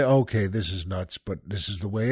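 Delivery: resigned private thought. Retold or imitated. Retold resigned thought while driving.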